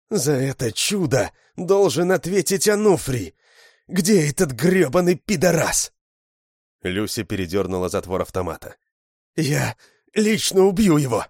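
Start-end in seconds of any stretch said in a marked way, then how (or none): 0.1-5.9 high, loud enraged shout; furious girl. Acted. Lyusya raging about Anufriy, high and loud. 9.4-11.3 high loud threat; vengeful shout. Acted. Lyusya cocking the rifle, high furious threat.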